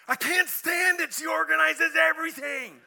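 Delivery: husband calling his counselor, shouting in distress; frantic shout. Retold or imitated. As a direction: burst out like a man at the end of his rope. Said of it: "I get a call from him".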